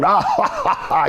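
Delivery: high mock belly laugh; put-on cackling ha-ha, pitch leaping up; imitated laugh. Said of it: "He's just laughing".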